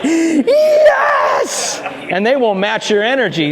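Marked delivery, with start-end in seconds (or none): 0.0-1.8 very high, squealed mock shout; over-excited dog. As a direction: shriek it like a dog thrilled at company. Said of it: "the dog is like".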